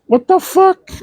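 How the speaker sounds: sudden high squeak of disbelief; mock-shocked yelp; shocked outburst. Reading an odd American word, shocked high squeak. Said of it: "I thought".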